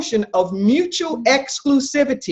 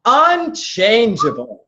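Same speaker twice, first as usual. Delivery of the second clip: "Unchangeable" stretched out slowly; emphatic echo; slower than usual. Real, not acted. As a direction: slowly confirm the answer, "Unchangeable". Repeats the right answer back.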